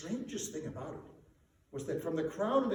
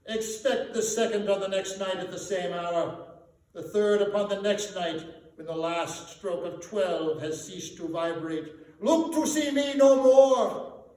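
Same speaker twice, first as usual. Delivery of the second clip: solemn ghost farewell; 2 semitones higher, 8 dB louder than usual. A narrator's reading. Marley's ghost leaving Scrooge, loud and final. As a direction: ring out a last farewell.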